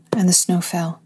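hushed whisper. Acted. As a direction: breathe the line out softly. Hushed refrain in lyrical reading.

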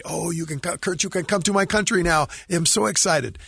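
bubbling over like a delighted friend; happy excited quote. Retold or imitated. Cuban bass player telling Kurt he can visit, excited. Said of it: "he was so happy to say".

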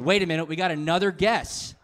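ordinary talk, nothing marked

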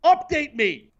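high, loud mock yell. Host mock-yelling at his producer to be updated, high and loud. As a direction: demand it slowly, hammed up. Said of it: "I have to yell at your son".

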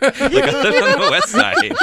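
loud, high, slowed by laughter; laughing boast. Real, not acted. Co-host joking to the others about Buffalo, laughing loudly. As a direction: crack up loudly while saying it.

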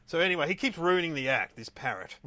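ordinary talk, nothing marked

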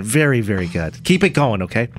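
usual speaking voice

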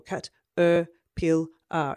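Cat, er, pil, ah broken into separate syllables; split into syllables. Shown as a demonstration. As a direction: chop the word into beats. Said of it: "what's the next syllable?".

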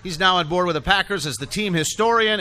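normal talking voice

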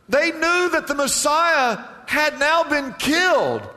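normal talking voice

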